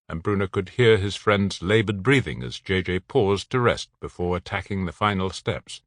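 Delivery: plain reading voice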